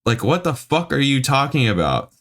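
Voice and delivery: high, exasperated swearing; mad. Real, not acted. Reader fed up with a pretentious review, mad. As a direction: challenge the writer, pitch shooting up then falling. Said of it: "this makes me mad".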